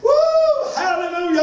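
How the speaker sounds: high joyful whoop; excited whoop. Real, not acted. Preacher whooping at his own point, high excited cry. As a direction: let out a big happy woo.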